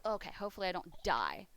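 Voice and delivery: normal talking voice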